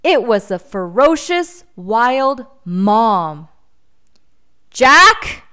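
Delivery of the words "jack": loud, high calling out; angry mother calling; roaring call. A narrator's reading. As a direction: bellow his name across the forest. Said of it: "she roared".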